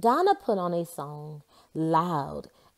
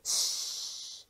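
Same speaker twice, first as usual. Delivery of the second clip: soft whispered shh; whispered shush; 9 dB quieter than usual. Acted. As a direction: hush someone quietly. Donna hushes her brother.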